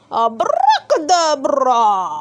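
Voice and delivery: loud, slow, drawn-out incantation; shouted magic word. A narrator's reading. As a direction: bellow the spell slowly. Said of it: "and shouted".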